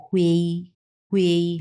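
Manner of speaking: হুয়েই with u gliding into ei; model pronunciation. Shown as a demonstration. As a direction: model হুয়েই twice, separated by a pause. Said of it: "এটা উচ্চারণ কীভাবে করব". (স্পষ্ট করে হুয়েই)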